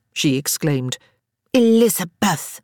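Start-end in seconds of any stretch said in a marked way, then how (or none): 1.5-2.6 scolding. A narrator's reading. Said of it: "Harriet scolded".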